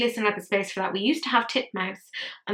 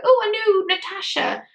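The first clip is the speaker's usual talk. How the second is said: mock eager shopper; 11 semitones higher than usual. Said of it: "just be like".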